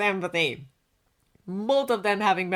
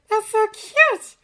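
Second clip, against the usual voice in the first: high, drawn-out delighted squeal; melting over something adorable; gushing; 8 dB louder, slower than usual. A narrator's reading. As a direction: gush it slowly, way up in pitch. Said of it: "gushed in a stage whisper".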